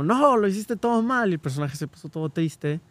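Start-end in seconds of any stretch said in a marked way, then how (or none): 0.0-1.3 regaño imitado. Retold or imitated. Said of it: "las chicas superpoderosas estaban como".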